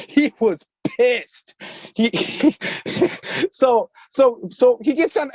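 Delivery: fighting the giggles mid-sentence; laughing while telling. Real, not acted. Telling about Obama's call, laughing. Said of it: "This shit's so funny".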